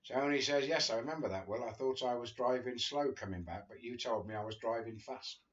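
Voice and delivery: ordinary delivery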